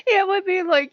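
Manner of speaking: loud, high burst of laughter; high laughing fit. Real, not acted. Laughing at her own weapon stash, laughing hard. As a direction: break into helpless laughter.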